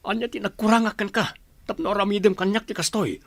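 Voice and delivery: angry. Acted. Husband confronting his unfaithful wife, hurt and angry. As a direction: demand why she did this, voice rising.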